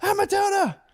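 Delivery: put-on high-pitched excited little-kid squeals; imitated kids shrieking in delight; screaming daughter. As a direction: squeal like a thrilled child. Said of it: "My daughter's scream".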